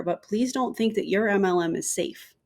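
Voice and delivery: ordinary talk, nothing marked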